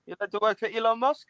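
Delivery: high, soft mock South African; put-on South African questioning; South African accent. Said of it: "you like my South African?".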